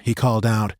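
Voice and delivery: usual speaking voice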